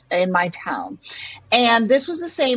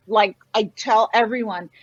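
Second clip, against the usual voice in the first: normal talking voice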